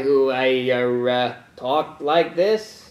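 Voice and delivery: slow, halting imitation of someone's odd voice; drawn-out, faltering mock voice; mock funny voice. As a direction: lightly imitate a man's funny way of talking, dragging it out. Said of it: "dude with the funny voice".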